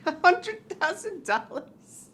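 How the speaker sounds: mock disbelief. Host mocks the prize idea.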